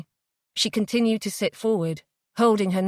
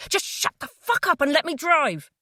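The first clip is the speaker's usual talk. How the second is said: angry shout; 9 semitones higher than usual. Acted. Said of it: "he shouted at her".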